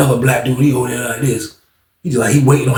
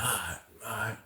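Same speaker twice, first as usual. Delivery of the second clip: very soft, low reply; hushed acceptance; 5 semitones lower, 22 dB quieter than usual. Retold or imitated. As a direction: let it go under your breath. Subdued acceptance of the answer.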